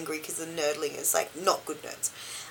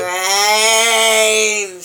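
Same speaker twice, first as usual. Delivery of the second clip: zombie; 9 dB louder than usual. Pretend. Zombie bit opening show.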